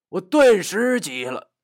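usual speaking voice

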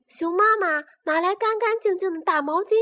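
usual speaking voice

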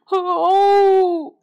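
put-on loud high wail of a child dinosaur; big tearful howl; crying wail. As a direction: let out a howling sob. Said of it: "哭着跑了".

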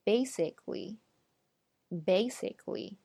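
basically slowly as BAY-sik-lee; three-syllable basically; stressed reduced form. Shown as a demonstration. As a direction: stretch the first syllable of basically. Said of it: "注意重音在哪个音节上".

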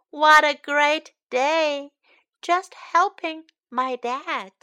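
put-on lively storybook read-aloud for kids; picture book read to young learners; reading to children. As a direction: read it brightly to little listeners.

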